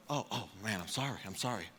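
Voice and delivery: low, very soft, sheepish apology; hushed apology. Retold or imitated. Friend told off during chapel, quietly apologetic. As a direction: back off quietly, under your breath.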